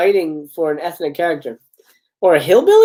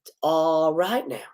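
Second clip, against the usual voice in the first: soft, slow, drawn-out reassurance; calm her like a scared rabbit; soothing drawl; 6 dB quieter, slower than usual. Acted. The truck driver soothes frightened Barbara, soft and slow. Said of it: "Soothingly in a drawl".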